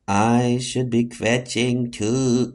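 slow, low hypnotized repeat; entranced echo. Acted. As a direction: repeat it as if under a spell. Echoes the spooky voice's suggestion.

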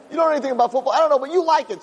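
high lightly voiced exchange; teasing husband, sweet reply.

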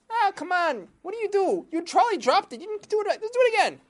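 high excited fisherman voices; squeaky fishermen bickering; high, loud fishermen egging each other on. Acted. Fishermen arguing over dropped net.

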